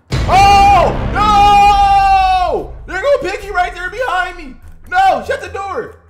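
loud, high frantic yells; panicked shouting. Real, not acted. Player shouting as Piggy chases him. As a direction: scream for your life while fleeing.

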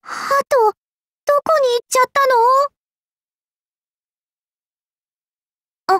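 high, helpless little wail; flustered, high-pitched lament; plaintive dismay. Acted. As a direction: wonder in dismay where the dove went. Magician lost the dove.

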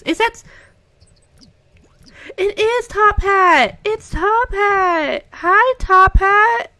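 high, loud, delighted; excited squeal. Real, not acted. Player spots the cat Top Hat, thrilled high squeal.

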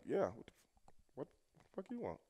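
very quiet retold inner thought; muttered to himself. Wrestler thinking privately as Ric Flair approaches, hushed aside to himself. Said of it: "saying to myself".

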